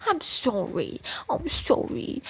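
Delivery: high, pained incredulous whine. Real, not acted. Streamer incredulous at the writer. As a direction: whine it in disbelief. Sarcastic rant.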